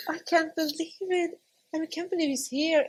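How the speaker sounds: amazed disbelief. Retold or imitated. Seeing newborn baby.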